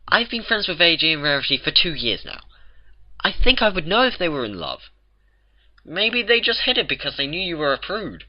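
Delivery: lightly voiced Twilight pleading reason. A narrator's reading.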